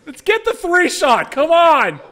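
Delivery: loud, high, drawn-out holler; impatient call-out. Pretend. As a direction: shout slowly to hurry the crew along. Calling out impatiently to crew.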